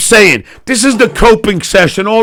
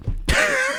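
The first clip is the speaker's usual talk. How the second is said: high laugh; 13 dB quieter than usual. Real, not acted. Laughs at own joke.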